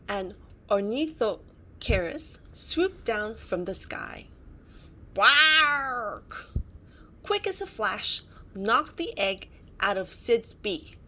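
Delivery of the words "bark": high, loud screeching cry; loud bird squawk. Acted. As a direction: squawk like a swooping reptile. Flying reptile swoops down.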